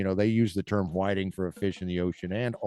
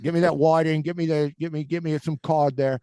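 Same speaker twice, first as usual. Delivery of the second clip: Aussie accent, badly put on; mock Australian; 5 semitones higher than usual. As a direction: fake Aussie bloke ordering fish. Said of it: "we're butchering your accent".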